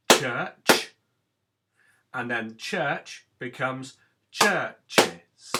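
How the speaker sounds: loud "church", then slow two-beat "churches"; slow loud syllable demo. Shown as a demonstration. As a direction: stress the added syllable in "churches". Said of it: "adding an extra syllable".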